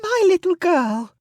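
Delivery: high, loud maternal delight; gushing mother. A narrator's reading. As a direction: exclaim in thrilled pride over a daughter. Lavinia gushing over her daughter.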